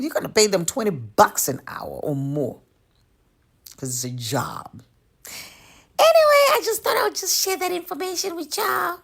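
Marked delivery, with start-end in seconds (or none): 6.0-9.0 high, sugary mock-friendly goodbye; sarcastic sweet farewell. Signing off to the arrivals with a high, sugary mock goodbye. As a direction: sing it out sweetly, all fake kindness.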